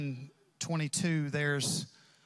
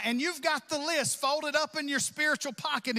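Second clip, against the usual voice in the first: ordinary delivery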